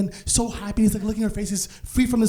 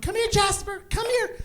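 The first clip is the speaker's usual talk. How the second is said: high-pitched coaxing call; calling a dog; 11 semitones higher, 4 dB quieter than usual. Retold or imitated. Calling Jasper over from the carpet, high coaxing voice. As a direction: coax a pet over in a high voice.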